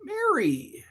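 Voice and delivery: high-pitched call. Real, not acted. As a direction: sing out the name. Greeting mom on phone.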